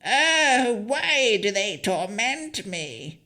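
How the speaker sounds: high, slow wailing hag voice; crying hag. Acted. The hag tearing her hair, high slow wail. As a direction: wail it like a tormented old crone. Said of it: "grabbed her matted hair and cried".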